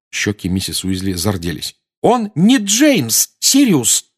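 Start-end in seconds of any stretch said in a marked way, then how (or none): 2.0-4.1 high, loud, very slow insistence; heated reproach. A narrator's reading. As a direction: drive each word home slowly, high-pitched. Mrs Weasley confronts Sirius, cheeks flushed. (медленно, громко и с укором)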